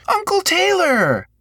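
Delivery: put-on a little boy calling out happily; excited child. A narrator's reading. Boy calls out in delight.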